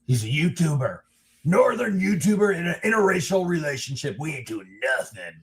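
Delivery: high, quick voice of indignant small-town officials; mock dismissive sheriff. Biased county sheriff refusing to help outsiders, mock dismissive. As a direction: put on a high-pitched scornful refusal.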